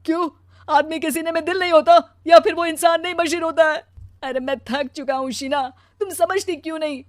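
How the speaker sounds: anguished protest, swelling then sinking; tearful outburst. Acted. Husband telling wife he is exhausted, tearful and hurt. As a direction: protest through tears, ending in a sob.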